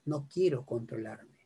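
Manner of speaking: low, soft admission; frank admission. Shown as a demonstration. Speaker voicing the real reason, low and quiet. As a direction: drop right down to confess it. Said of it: "Lo real es".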